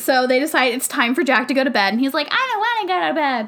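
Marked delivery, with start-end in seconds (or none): 2.3-3.5 put-on high, fast, whining child; mock childish protest; whiny kid. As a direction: pout like a kid at bedtime. Voicing boy refusing bedtime.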